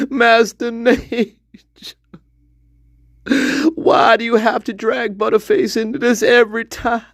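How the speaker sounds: high, crying hillbilly plea; mock sob to Master Nate; pleading hillbilly. Voicing a pleading hillbilly character.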